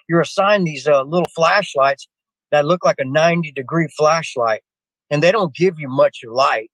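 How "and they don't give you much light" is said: ordinary talk, nothing marked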